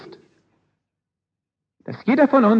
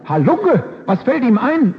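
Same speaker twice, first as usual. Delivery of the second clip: furious roar; 8 semitones higher, slower than usual. Acted. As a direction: let the king roar at the servant. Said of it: "brüllte der erzürnte König". (Der König brüllt den Diener empört an)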